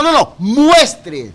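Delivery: no, no, muestre spelled out slowly, muestre landing hard; slowing down to drive home muestre; slow emphatic stress. Shown as a demonstration. Preacher correcting the congregation, slow and stressed. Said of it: "y enfatizo la palabra".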